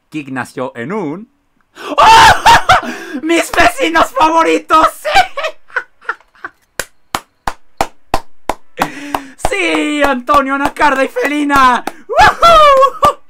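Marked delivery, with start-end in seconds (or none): 2.0-13.2 very high, very loud laughing screams; ear-splitting happy screaming at the party; mega scream. Real, not acted. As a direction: shriek with joy at the top of your lungs. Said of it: "no hacía falta el mega grito".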